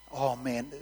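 normal talking voice

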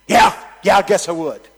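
loud high brash reply; big heckler. Retold or imitated. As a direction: brawler voice, cocky. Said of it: "And the guy goes".

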